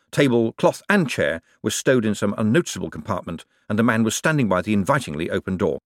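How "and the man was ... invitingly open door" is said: usual speaking voice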